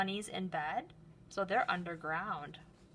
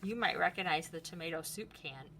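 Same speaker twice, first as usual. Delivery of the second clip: ordinary talk, nothing marked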